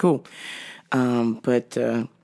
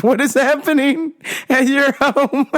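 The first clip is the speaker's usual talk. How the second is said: disbelief; 10 semitones higher than usual. Real, not acted. Incredulous at orange hair.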